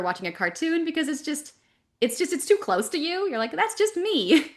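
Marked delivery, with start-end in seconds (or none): 3.5-4.5 high, drawn-out comic realisation; mock self-recognition. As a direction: stretch it out high, as if spotting yourself on screen. Acts out recognising her own voice.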